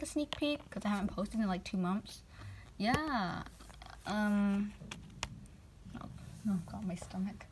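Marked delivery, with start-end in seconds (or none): 6.0-7.5 pained whisper to herself. Real, not acted. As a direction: breathe it out, holding your stomach. Blooper aside to self.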